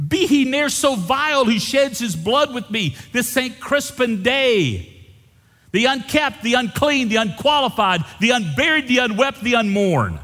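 high, loud ringing recitation; fiery Shakespeare quote. Acted. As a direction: declaim it like a battle speech. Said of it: "To quote Shakespeare".

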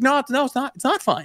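host protesting to the fan; mock insistence. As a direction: insist it isn't fine. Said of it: "I was like".

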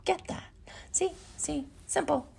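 whispered, confiding aside; hushed aside. Real, not acted. Host lets listeners in on how simple it is, hushed. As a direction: whisper it like an easy secret.

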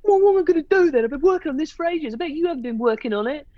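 high-pitched, exasperated retelling; livid retort. Retold or imitated. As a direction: act out being livid at a husband. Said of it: "I was livid".

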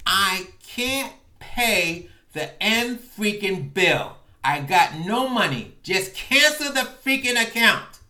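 loud, heated rant; furious rant. Retold or imitated. Raging at the agent to cancel the account, loud and heated. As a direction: vent at the agent, fuming. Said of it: "foaming at the mouth".

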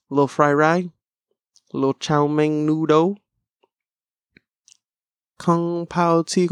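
put-on food counter worker voice; Panda Express worker. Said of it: "Panda Express worker".

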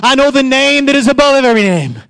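high, shouted declarations; rousing preacher building to a high, sustained cry; full-force preaching. Real, not acted. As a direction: proclaim it over the congregation.

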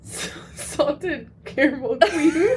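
crying with laughter, slow; can barely get the words out laughing. Real, not acted.

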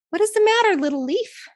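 high, small voice of a little twig; a tiny twig asking gently. Acted. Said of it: "And so the twig asked".

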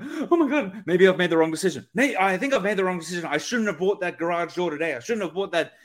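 acted fretting, quick and spiralling; hurried, worried second-guessing, hammed up a little; mock buyer's remorse. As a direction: play a customer talking themselves into a panic. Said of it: "been left in a negative feeling".

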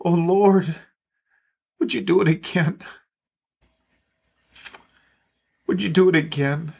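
hushed pleading student at prayer; a young student praying by the bed; student's prayer. Retold or imitated. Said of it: "praying with his face on the bed".

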